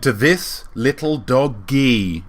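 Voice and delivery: doggy with the second syllable punched; slow toast, leaning on the end of doggy; stress on second syllable. Real, not acted. Host toasts the little doggy so it rhymes, stressing doggy's second syllable. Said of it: "emphasis on the, the second syllable".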